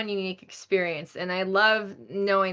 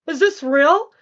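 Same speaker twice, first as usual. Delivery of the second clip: high, loud, giddy questioning; incredulous amazement; 7 semitones higher, 8 dB louder than usual. Retold or imitated. Voice actress hearing her own performance, incredulous. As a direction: question it loudly, thrilled and disbelieving.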